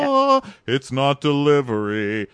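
slow, slick commercial announcer. Retold or imitated. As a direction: sell the pizza slogan slowly. Quoting the pizza commercial.

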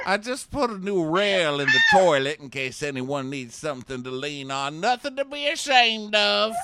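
playful old man impression. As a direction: ham up a creaky old construction worker. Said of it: "old man construction worker is like".

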